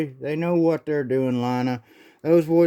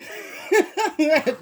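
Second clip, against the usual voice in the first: high giggle; 11 semitones higher than usual. Acted. Doc laughs in baby voice.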